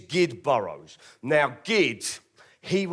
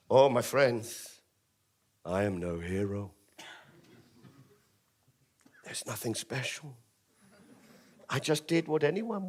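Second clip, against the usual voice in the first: mock humble; 3 semitones lower, 5 dB quieter than usual. Mock-heroic speech.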